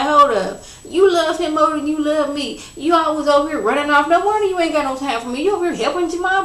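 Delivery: put-on high, fast, whining teenage complaint; whiny son. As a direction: whine at mom like a jealous kid.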